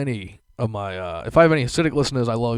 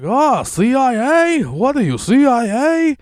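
much higher, slow, loud jeering call; mocking deli guy; 10 dB louder, slower than usual. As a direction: taunt him across the line. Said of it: "yells over the whole line".

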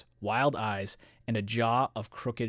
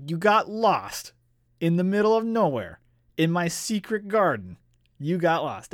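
thrown-back monster; 6 semitones higher, 4 dB louder than usual. Retold or imitated. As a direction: echo the excuse in comic disbelief, pitched up. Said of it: "this monster is totally thrown back".

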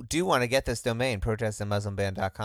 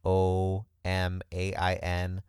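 spelling it out slowly; 3 semitones lower, slower than usual. Shown as a demonstration. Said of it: "just the numbers".

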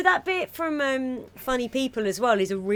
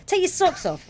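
high-pitched acted house-rule order; host giving orders; 11 semitones higher than usual. Host telling a visitor about socks, high bossy order. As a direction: play the host, pitched well up. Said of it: "they say".

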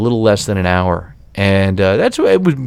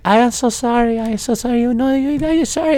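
much higher, mild mock apology; apologetic lady, imitated; 11 semitones higher than usual. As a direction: lightly voice her fussing sorry. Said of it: "And she's like".

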